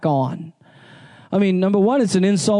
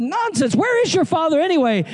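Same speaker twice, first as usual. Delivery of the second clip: cheerful farmer; 10 semitones higher than usual. Retold or imitated. Farmer brushing off boy's worry.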